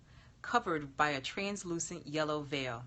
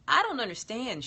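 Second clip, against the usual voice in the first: higher and louder, confused; puzzled frown; 6 semitones higher, 4 dB louder than usual. Acted. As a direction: say it bewildered, pushing back. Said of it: "she frowned".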